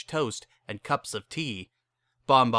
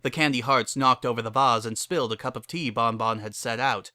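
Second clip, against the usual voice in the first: usual speaking voice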